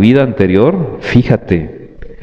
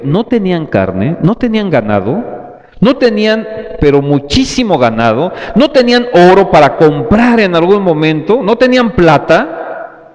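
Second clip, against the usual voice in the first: preaching at full force; 6 semitones higher than usual. Acted. Preacher stirring the congregation about the Israelites, fervent. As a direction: hammer each "no tenían" home. Preacher builds an impassioned list.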